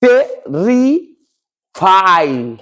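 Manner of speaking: टेरिफाइड stretched very long, syllable by syllable; very slow demo pronunciation. Shown as a demonstration. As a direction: drag out टेरिफाइड for the class. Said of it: "clear है?". (बहुत धीमा उच्चारण)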